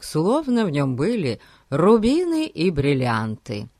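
ordinary delivery, nothing marked

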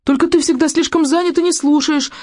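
high-pitched, aggrieved son; mildly wretched complaint to father; miserable protest. A narrator's reading. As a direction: answer back unhappily, pitch climbing. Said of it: "отозвался несчастный Икинг".

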